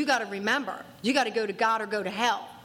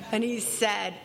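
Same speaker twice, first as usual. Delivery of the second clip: ordinary talk, nothing marked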